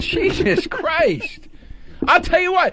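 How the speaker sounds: high, exasperated outburst; yelling. Real, not acted. Comic yelling at his co-host in an argument over singing. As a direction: pitch shoots up in exasperation. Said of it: "why are you yelling?".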